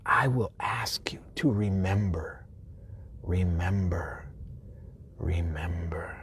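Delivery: slow whisper, fading to almost nothing. Acted. Intimate call to remember.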